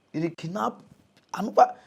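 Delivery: usual speaking voice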